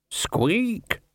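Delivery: tiny high-pitched squeak; high rat squeak. A narrator's reading.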